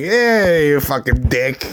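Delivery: ordinary talk, nothing marked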